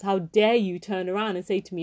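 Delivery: ordinary delivery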